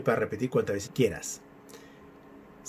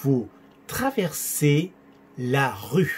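very slow, syllable by syllable; careful, drawn-out French with pauses; pronunciation practice; much slower than usual. Shown as a demonstration. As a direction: model it slowly for learners to copy. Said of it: "practicar un poco de pronunciación".

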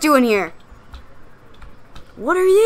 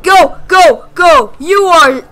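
panicked; 2 semitones higher, 6 dB louder, slower than usual. Real, not acted. Fleeing the monster.

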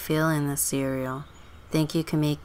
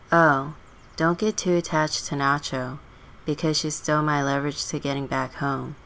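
ordinary talk

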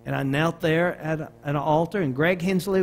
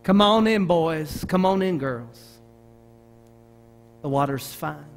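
gentle invitation; 2 semitones lower than usual. Acted. Preacher gives Jesus's invitation to the congregation, gentle and hushed toward the end. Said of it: "that's what Jesus says to us".

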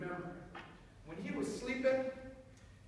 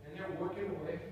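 usual speaking voice

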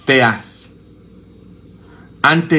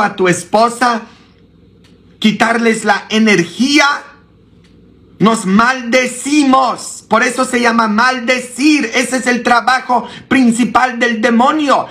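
much higher, impassioned sermon with a shout; fiery preaching; 10 semitones higher than usual. Acted. Priest scolding his flock about cursing, impassioned high preaching. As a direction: preach it hard against cursing.

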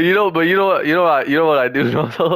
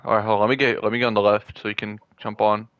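ordinary talk

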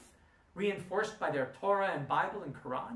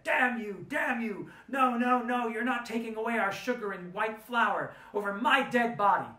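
high, loud furious cries; angry tearful outburst; 7 semitones higher, 6 dB louder than usual. Retold or imitated. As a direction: lash out at him, near tears. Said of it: "burst into angry tears and said".

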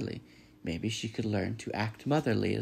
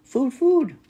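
put-on very high, loud baby-bird demand; piercingly high hungry hatchling voice; hungry gosling; 12 dB louder than usual. A narrator's reading. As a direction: peep urgently for food. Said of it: "The gosling was hungry".

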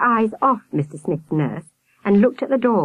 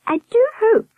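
very high warbling falsetto; high-pitched nurse; 4 dB louder than usual. A narrator's reading. Nurse worrying for the old folk, high warble. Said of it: "the high-pitched voice".

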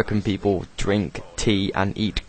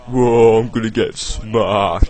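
mocking brother; 6 dB louder, slower than usual. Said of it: "me taking the piss out of my brother".

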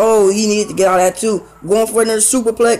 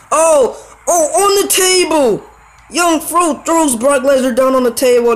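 excited shout; 6 semitones higher than usual. Real, not acted. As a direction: yell out as he crashes through the table. Big table spot in match.

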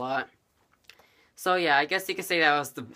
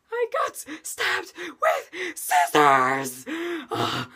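acted gasps between slow, broken words; gasping in pain; 5 dB louder, much slower than usual. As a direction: heave a breath on every word, hammed-up. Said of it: "like, breathing, like every word".

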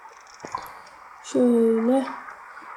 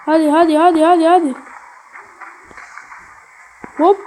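urgent cheering; 3 semitones higher, 5 dB louder than usual. Real, not acted. Cheering character across gap.

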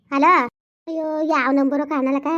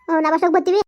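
ordinary talk

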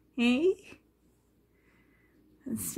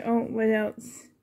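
ordinary talk, nothing marked

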